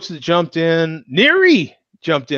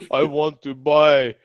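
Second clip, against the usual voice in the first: slow, raised mock Russian accent; 4 semitones higher, 3 dB louder, slower than usual. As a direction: order beer in a hammed-up Russian accent. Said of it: "accent for him".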